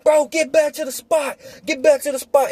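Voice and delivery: high, pressing urge to go; imitating urgent friends. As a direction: voice the friends rushing him back. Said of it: "They was like".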